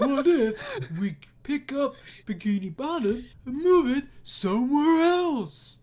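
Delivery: high, slow, goofy cartoon voice; drawn out and loud, way up; cartoon Patrick voice. Quoting the Patrick meme.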